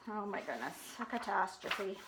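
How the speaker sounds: soft, low shocked murmur; hushed aside. Real, not acted. Reader's hushed reaction.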